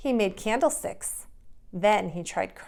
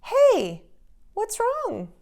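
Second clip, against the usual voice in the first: concerned greeting; 14 semitones higher, 6 dB louder, much slower than usual. Acted. Friendly shopkeeper greets sad boy.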